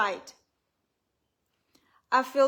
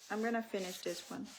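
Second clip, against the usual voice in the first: ordinary talk, nothing marked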